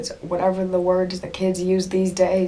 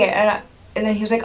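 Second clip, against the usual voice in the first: normal talking voice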